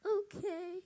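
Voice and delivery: put-on high little girl's okay; a young girl agreeing on the phone. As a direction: chirp it like a small child. Child answers the question.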